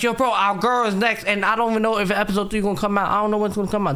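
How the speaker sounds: high, fast-flowing venting, fading at the end; exasperated ranting. Retold or imitated. Viewer griping to bro about episode three, exasperated. Acting out own frustrated reaction.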